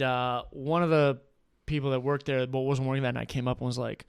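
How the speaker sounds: ordinary talk, nothing marked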